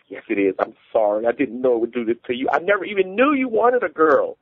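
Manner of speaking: rising high-pitched plea; pleading. A narrator's reading. Wife apologizing to her husband over her lie, pleading.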